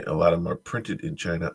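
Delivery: usual speaking voice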